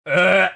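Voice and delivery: loud, pained gagging groan; disgusted groan. Real, not acted. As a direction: groan like you might be sick.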